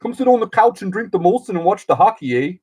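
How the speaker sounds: high, hammed-up Canadian buddy voice; mock Canadian. Mocking how Canadians greet an American, hammed-up high. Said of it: "and they're like".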